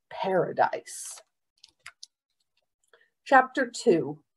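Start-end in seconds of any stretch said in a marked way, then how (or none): none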